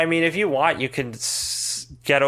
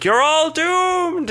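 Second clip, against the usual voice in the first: high, slow, loud doom cry; slow ominous raspy drawl; 5 dB louder, much slower than usual. Acted. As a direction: drag out a shouted doomed. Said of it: "he's just goes".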